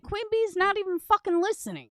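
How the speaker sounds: high-pitched re-enacted protest; mock-exasperated, pitched well up; retold exasperated complaint. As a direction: push the voice up high, quoting your own complaint. Re-enacts complaint made during the live.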